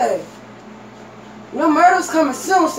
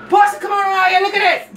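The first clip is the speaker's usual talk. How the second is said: calling out; 6 semitones higher than usual. Acted. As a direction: holler to come look. Calling Portia.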